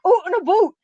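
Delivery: high, mock Canadian "oot and aboot"; Canadian accent. Host joking about Canadian friends, mock Canadian accent. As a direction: play up the Canadian vowels. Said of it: "I love how the Canadians talk".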